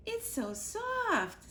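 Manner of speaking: very high, slow and cooing; tiny chick voice. A narrator's reading. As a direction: coo it like a tiny excited chick. Little chick coos over squirrel.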